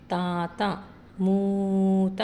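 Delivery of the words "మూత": మూత with the ఊ held very long and louder; మూత said with a long, drawn-out ఊ; long vowel stretched. Shown as a demonstration. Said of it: "మాకు కొమ్ము దీర్ఘం ఉంది కాబట్టి మూత అని చదవాలి".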